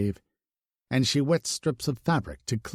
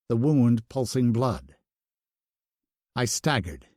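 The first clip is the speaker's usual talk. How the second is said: ordinary delivery, nothing marked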